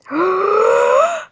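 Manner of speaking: loud, high-pitched gasp of delight; excited gasp. Real, not acted. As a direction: gasp loudly at a wonderful surprise. Opens bag three, amazed.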